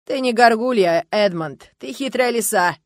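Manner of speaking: loud, teasing jibe; mocking. A narrator's reading. Kastro calls out Edmond's cunning, mockingly. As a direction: needle him with amused scorn. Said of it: "насмешливо произнёс Кастро".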